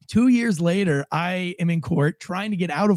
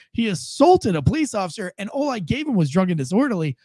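ordinary talk, nothing marked

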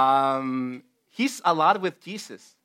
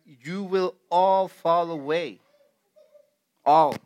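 slow, drawn-out line, "all" repeated alone and louder; stress on all; 5 dB louder, much slower than usual. Retold or imitated. Preacher quoting Jesus to the group, weighty stress on "all". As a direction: lean heavily on "all", then let it stand alone.

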